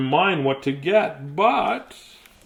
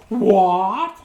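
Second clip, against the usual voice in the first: mock gasp; 3 semitones higher, 3 dB louder than usual. Reader finds the pet under the desk, mock gasp. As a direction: gasp then a sharp what.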